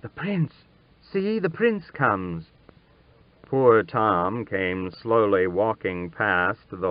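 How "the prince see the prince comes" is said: excited murmur. A narrator's reading. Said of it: "a general buzz".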